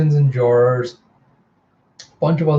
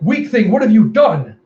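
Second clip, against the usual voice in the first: high, loud, horrified cry; shocked outcry; 8 semitones higher, 6 dB louder than usual. Acted. Stabbed sister cries out in horrified shock. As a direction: scream it in disbelief at the betrayal.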